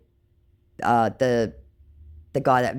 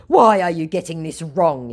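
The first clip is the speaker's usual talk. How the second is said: loud mock-whiny scammer; 5 semitones higher, 7 dB louder than usual. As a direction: whine like the scammer. Scammer quoted.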